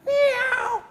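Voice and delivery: high, squeaky put-on meow; escaping prisoner faking a cat's meow, high and loud. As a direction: pretend to be a cat to fool the guards. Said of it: "and he says".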